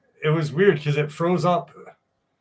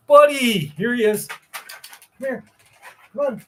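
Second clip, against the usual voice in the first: excited dog greeting; 11 semitones higher, 4 dB louder than usual. Real, not acted.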